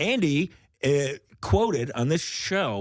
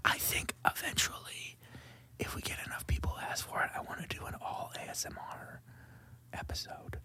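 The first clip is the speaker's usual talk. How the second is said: soft ASMR whisper; 10 semitones higher, 12 dB quieter than usual. Real, not acted. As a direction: whisper close to the mic.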